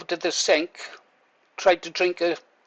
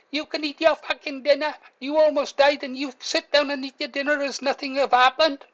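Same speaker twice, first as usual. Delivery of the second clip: much higher, exasperated telling-off; scolding wife; 9 semitones higher than usual. Retold or imitated. Wife berating him for eating after nearly dying, higher exasperated scolding. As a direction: mimic the wife's outraged scolding.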